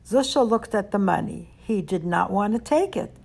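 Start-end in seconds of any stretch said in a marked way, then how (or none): none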